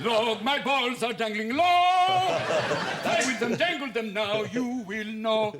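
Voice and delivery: high, slow, loud pompous operatic voice; mock opera tenor. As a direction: ham it up like a tenor on stage. Parodying [name] singing opera.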